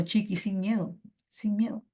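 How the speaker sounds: usual speaking voice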